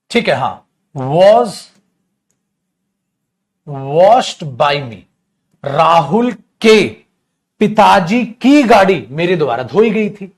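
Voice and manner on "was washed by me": very slow dictation. Shown as a demonstration. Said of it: "dictating slowly".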